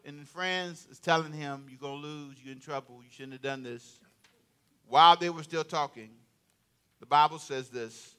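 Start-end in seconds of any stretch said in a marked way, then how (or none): 1.7-4.0 soft, low foreboding warning; hushed warning. Acted. Wife and friends warning Haman, softly ominous. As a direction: murmur the bad news.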